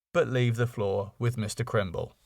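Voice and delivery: usual speaking voice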